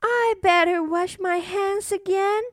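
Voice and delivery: put-on high worried little girl; cartoon princess. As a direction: fret like a small princess. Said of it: "said the little princess".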